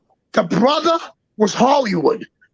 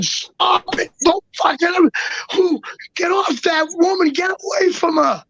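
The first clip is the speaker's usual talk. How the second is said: high-pitched mock scream, no real volume; retold screaming; 7 semitones higher than usual. Teller relives yelling at an imagined attacker, retold screaming. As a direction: imitate frantic screaming, pitched well up. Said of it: "I started screaming".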